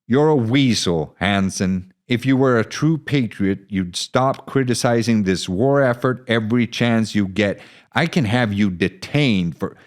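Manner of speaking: captain snarling a threat; angry captain. Acted. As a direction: lash out at the weasel.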